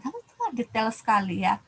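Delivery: ordinary delivery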